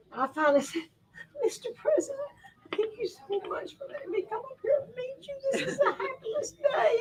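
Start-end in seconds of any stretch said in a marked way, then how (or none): 1.4-7.0 tearful thanks. Retold or imitated. Thanking the president through tears and laughter. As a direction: squeak it out, near tears.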